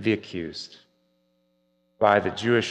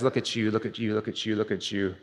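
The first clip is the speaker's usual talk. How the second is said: mild mocking devil; 6 dB quieter than usual. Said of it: "the devil points at you and says".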